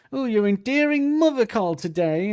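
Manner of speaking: high-pitched, exaggerated mimicry; mock sarcastic dig. Man mocking the lyric's dig about mother, exaggerated sarcasm. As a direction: pitch it well up, hamming the jibe.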